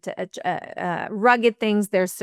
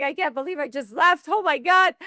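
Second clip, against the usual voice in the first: higher, breathless amazement at herself; excited disbelief; 7 semitones higher than usual. Retold or imitated. In the cab after walking out, thrilled disbelief. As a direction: gasp it out like you can't believe you did it.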